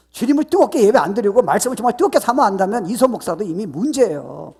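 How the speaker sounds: loud, high, driving pulpit voice; full-force preaching. Acted. Pastor exhorting the congregation, in a loud preaching voice.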